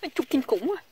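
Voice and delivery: chewing sour grape, wincing; eating sour grapes. Shown as a demonstration. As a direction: show the sour chew, pained. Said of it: "ăn mà nhai nhai nhai nhai á".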